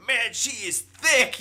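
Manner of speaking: high, slow ogling voice; mock lustful voice. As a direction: drawl it like a leering guy. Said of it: "look at your phone earlier and go".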